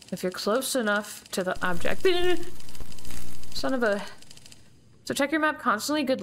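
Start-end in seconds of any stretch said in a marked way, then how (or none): 2.1-3.5 nervous laugh. Real, not acted. Nervous laugh at jump scare.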